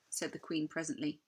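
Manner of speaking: plain reading voice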